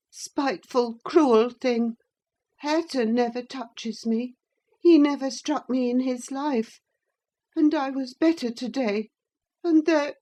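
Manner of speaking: high, self-pitying complaint; high boy's whine dying away; whimpering boy. A narrator's reading. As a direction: pile on the grievance.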